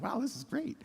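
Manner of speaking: a hint of a bright counselor praising a kid; guidance counselor. Counselor encouraging a shy third grader, high and cheery.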